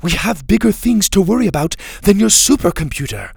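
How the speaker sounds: high, loud, impatient snap; snapped retort. A narrator's reading. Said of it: "Mora snapped".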